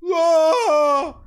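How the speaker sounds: high, loud goat-like "Ah!"; imitated goat scream. Man imitating a goat on the mountain, high loud scream. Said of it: "when it screams, it goes".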